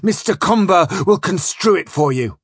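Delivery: loud, high, emphatic rage; furious tirade. A narrator's reading. Captain warning Flashman with Seneca on the anger of kings, furious. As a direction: hammer out each threat like an enraged tyrant.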